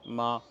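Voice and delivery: MA held level and bland; emotionless, unvarying ma; monotone first tone. Shown as a demonstration. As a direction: keep ma on one even pitch. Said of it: "ça va extrêmement ressembler au ma".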